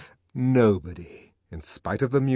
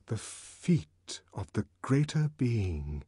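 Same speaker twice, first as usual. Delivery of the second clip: slow, soft refrain; suspenseful, softened echo; hushed; 7 dB quieter, slower than usual. A narrator's reading. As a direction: breathe it out slowly and quietly. Awed echo of whispered words.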